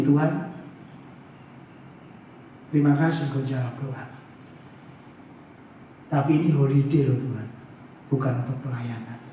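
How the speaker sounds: low, soft, intimate talk with God; tender prayer. Retold or imitated. Pastor thanking God during a holiday, tender and hushed. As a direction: speak humbly and quietly, pausing between thoughts.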